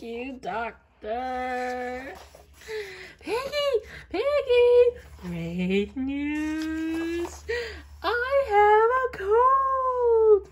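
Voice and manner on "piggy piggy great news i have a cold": high, loud, slow joyful calls; excited news. Acted. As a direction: shout the happy news. Gerald runs to tell Piggy.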